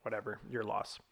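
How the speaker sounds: low, quiet shrug-off; retold dismissiveness. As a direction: toss it away softly, as if unbothered. Retold shrug at being second choice.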